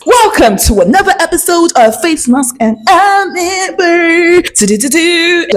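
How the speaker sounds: high, drawn-out playful announcer welcome; sing-song show intro. Pretend. Host greets viewers of a skincare podcast, sing-song. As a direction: stretch it out into a silly high jingle. Opens the show with catchphrase jingle.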